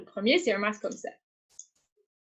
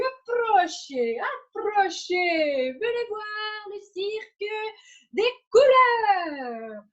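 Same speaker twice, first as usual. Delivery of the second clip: circus ringmaster; 4 semitones higher, slower than usual. Acted. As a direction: draw the crowd like a ringmaster. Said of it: "je deviens la personne qui présente".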